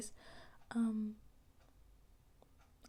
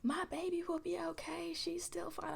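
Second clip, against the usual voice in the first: parent quote; 8 semitones higher, 3 dB quieter than usual. Retold or imitated. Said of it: "and he's like".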